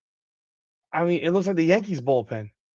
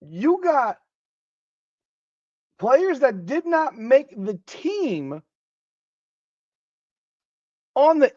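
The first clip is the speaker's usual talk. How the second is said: higher, slow, stretched-out disbelief; incredulous rant; 7 semitones higher than usual. Real, not acted. Streamer venting to viewers about Caitlin Clark, high and slow. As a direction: stretch every word out in outrage. Ranting at chat about team images.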